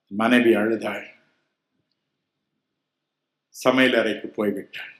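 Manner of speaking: ordinary delivery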